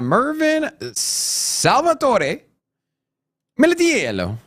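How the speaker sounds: put-on slow, drawn-out hammy Italian accent; bad Italian accent. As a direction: stretch the name in a clumsy Italian accent. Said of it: "That was the worst Italian accent".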